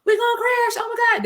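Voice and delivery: high, loud panic, mocking her younger self; panicked cry. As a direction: shriek it like you're sure you'll crash. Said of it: "I'm panicking in the ambulance".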